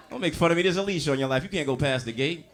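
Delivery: ordinary talk, nothing marked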